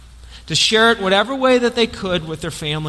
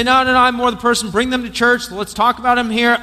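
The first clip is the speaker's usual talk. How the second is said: mock church member; 5 semitones higher, 3 dB louder than usual. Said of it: "Some of you may say".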